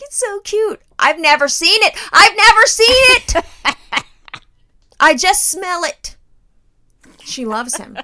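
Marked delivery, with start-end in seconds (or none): 0.0-0.8 mock cutesy gush. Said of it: "Everybody's like". 1.0-1.9 loud complaint. Real, not acted. Ranting about unseen hamster. 2.1-6.1 high, loud and slow, laughing in disbelief; high, slow exasperated wail. Real, not acted.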